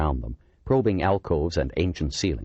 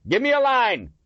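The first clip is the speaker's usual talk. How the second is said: shouted command; 4 dB louder than usual. A narrator's reading. Command during ambush.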